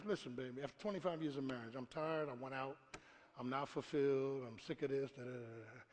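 low, soft weary listing of grievances; mock complaint to wife. Husband unloads his grievances on his wife, soft and weary. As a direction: sigh it out like a husband who's had enough.

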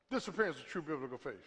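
quiet fast repeat. Shown as a demonstration.

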